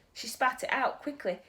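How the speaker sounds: ordinary delivery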